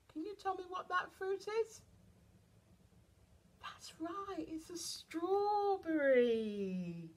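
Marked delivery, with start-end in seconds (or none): 3.6-7.1 high, drawn-out excitement; slow, high sing-song praise; delighted reveal. Acted. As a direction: celebrate the answer, stretching the last word. Praises child, reveals strawberry.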